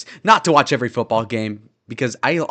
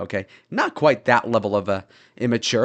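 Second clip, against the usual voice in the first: ordinary talk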